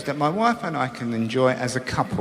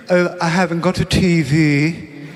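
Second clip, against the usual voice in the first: mock pompous; 4 dB louder, slower than usual. Pompous people quoted.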